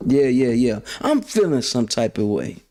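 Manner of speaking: normal talking voice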